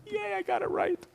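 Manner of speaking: very high-pitched "yay"; little mock cheer. Host celebrates getting a name right, high mock cheer. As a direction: squeak out a small victory cheer.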